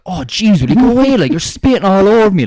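high, loud mock complaint; exaggerated disgust. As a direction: whine it like a cheeky teenager. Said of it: "I was like".

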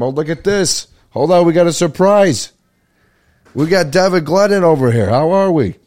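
loud, high burst of welcome; light up, a surprise guest just walked in; excited greeting. Real, not acted. Greeting a friend who walks in on the podcast, loud and excited.

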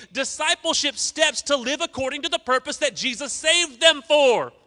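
high, loud fired-up sermon; full-force preaching. Acted. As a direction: preach it to the back row.

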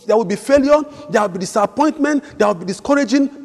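very high, loud fervent preaching; preaching at full force. Acted. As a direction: shout it out as the sermon's climax. Climax of sermon.

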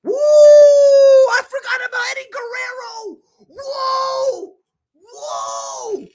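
high shrieking yell of sudden realization; high, loud whoops of disbelief; excited shout. Real, not acted. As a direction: scream it out, thrilled and floored. Sudden realization of forgotten wrestler.

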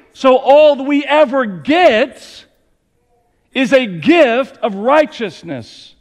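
mounting preaching fervor, slowing at the top; rising preaching. Acted. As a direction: build the argument toward its peak. Building sermon point.

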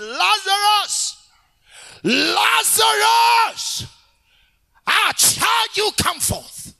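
huge, high, drawn-out summoning call; loud-voiced shout. Preacher as Jesus at the tomb of Lazarus, long high shout. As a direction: command the dead out at full force. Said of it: "he shouted with a loud voice".

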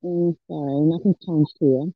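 muffled, churning mic sound; like talking from inside a washer; washing machine sound. Real, not acted. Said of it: "you sound like you're in a washing machine".

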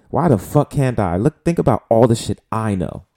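usual speaking voice

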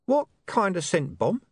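slow, strained voice; forced out through strain. Acted. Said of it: "It sounded rather strained".

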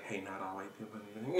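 very soft, trailing-off objection; hushed mock protest. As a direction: pretend to butt in, under your breath. Imagined objection, voice dropped away.

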